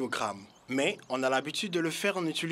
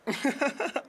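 merchant's amused chuckle; 8 semitones higher than usual. Acted. Merchant laughs at question.

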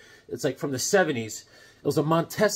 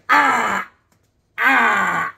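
louder, higher cries of pain; pained yelp; 6 semitones higher, 7 dB louder than usual. Retold or imitated. Crashed kid with a scraped back, crying out in pain. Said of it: "My whole back was like".

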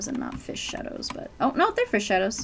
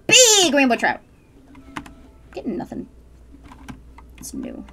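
ordinary talk, nothing marked

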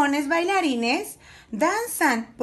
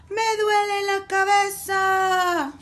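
cross dragon's complaint; 5 semitones higher than usual. A narrator's reading. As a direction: complain crossly, stretching the last word. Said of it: "un dragón enojado reniega".

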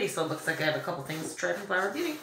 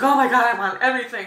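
higher, louder mock gush; excited gushing; 5 semitones higher, 8 dB louder, faster than usual. Vlogger retelling her reaction to the series, excited. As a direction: retell your excited outburst, voice jumping up.

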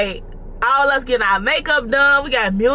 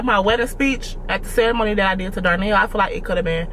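normal talking voice

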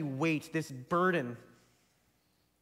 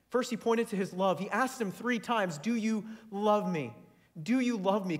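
preaching at full force; 4 semitones higher than usual. Acted. Preacher urging the congregation to look to love, fervent. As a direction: drive the message home from the pulpit.

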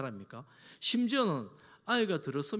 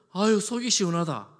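a hint of a relieved mother, a touch louder; 3 semitones higher, 7 dB louder than usual. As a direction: sigh it out like a mother freed at last. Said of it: "막 이래야 되는데".

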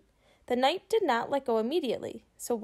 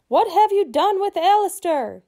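high, loud little knight voice; tiny knight calling out; 9 semitones higher, 8 dB louder than usual. Acted. Tiny knight calls up to the boy, high and loud. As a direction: call out like a tiny fierce knight. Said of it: "he called out, his voice a tiny croak".

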